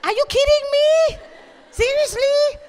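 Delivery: very high, loud comic squeal; hammed-up outraged shock, far up in pitch; shrieked disbelief. Singer answering God's order to lie prostrate, shrieked disbelief.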